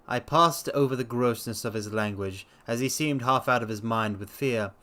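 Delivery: ordinary delivery, nothing marked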